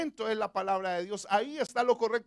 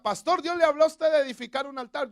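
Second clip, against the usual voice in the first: lightly acted question, higher and louder; raised-voice question to the pastor; questioning congregant; 5 semitones higher, 8 dB louder than usual. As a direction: put an eager push on the question, lightly voiced.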